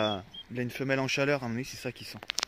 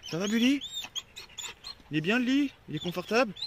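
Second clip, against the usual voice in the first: high cooing questions to the dog; 6 semitones higher than usual. Real, not acted. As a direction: ask the dog sweetly, high. High baby talk to dog.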